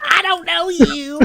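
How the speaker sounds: high, loud, drawn-out cartoon boy yell; imitated boy's squeal; Bobby. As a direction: shriek it like a panicked cartoon kid. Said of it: "he's like".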